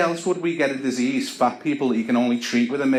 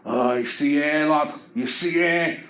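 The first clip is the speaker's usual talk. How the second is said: higher, slow leering lad voice; slow higher leering boast; nightclub lad; 5 semitones higher, slower than usual. Retold or imitated. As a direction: leer like a cocky meathead in a club. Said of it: "He said".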